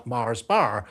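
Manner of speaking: slow Lancashire "Mars Bar" with the Rs sounded; Lancashire accent. Shown as a demonstration. Radio host demonstrating the local R, strong Lancashire accent. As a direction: stretch "Mars Bar", Lancashire Rs fully pronounced. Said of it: "it's the way we say R".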